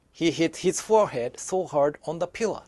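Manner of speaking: plain reading voice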